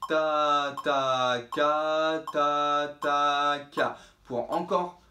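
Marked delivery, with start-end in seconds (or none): 0.1-4.0 ta ta ka spoken one per click, evenly spaced; steady articulation drill, one syllable per click; tonguing syllables. Shown as a demonstration. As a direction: tap out each syllable on the metronome beat. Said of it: "on va faire d'abord sur chaque clic".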